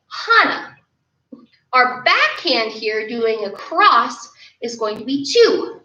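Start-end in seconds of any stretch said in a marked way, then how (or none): 0.1-0.8 "hana" said HAH-nah, stress on the first syllable, falling; teaching kids "hana" as HAH-nah, a clear Korean count. Shown as a demonstration. 한국어 "하나", 첫 음절에 힘주어. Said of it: "or in Korean, it's".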